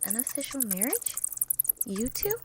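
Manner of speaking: soft, rising disbelief; quietly stunned question; surprised. Acted. Twilight hearing about the marriage, softly surprised.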